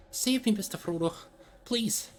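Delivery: high, breathless pleading; gasped plea. A narrator's reading. Sam begging Frodo from the river, gasping plea. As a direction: gasp it out half under water. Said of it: "gasped Sam".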